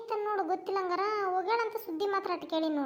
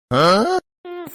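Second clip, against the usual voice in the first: sharp loud contemptuous hmph; loud scoffing hum; 4 semitones lower, 11 dB louder, much slower than usual. Acted. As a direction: huff at them loudly. Scoffing at the gossiping neighbours. (ಜೋರಾದ ತಿರಸ್ಕಾರದ ಹ್ಮ್)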